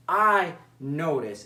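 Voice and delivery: I said slow and firm, leaning on I; stress on I. Shown as a demonstration. Coach stresses I to teach I statements, slowly. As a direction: land on the I in I notice. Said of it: "notice I use the word I".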